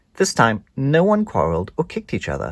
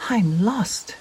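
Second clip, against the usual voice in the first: wail; 11 semitones higher than usual. Acted. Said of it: "she wailed".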